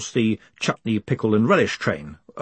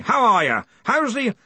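high, booming yell; Ridcully; 13 semitones higher than usual. Acted. Arch-Chancellor asking after a distant researcher, yelling. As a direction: yell the small talk. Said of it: "yelled Ridcully".

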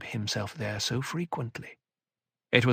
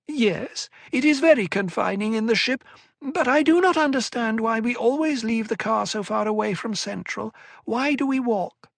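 whining elfin voice; 11 semitones higher, 3 dB louder than usual. A narrator's reading. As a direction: whine sweetly like a small schemer. Tleilaxu Cytail's voiced lines.

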